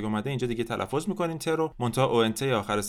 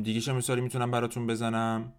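normal talking voice

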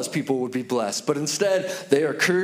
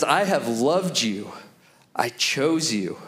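slow, lower, deliberate; tender assurance; 4 semitones lower, slower than usual. Retold or imitated. God looking at you in your brokenness, tenderly assuring. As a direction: settle into heartfelt, measured sincerity.

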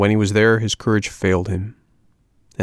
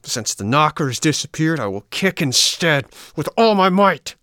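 angry shout; 7 semitones higher than usual. A narrator's reading. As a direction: build to a furious shout. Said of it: "blind with rage".

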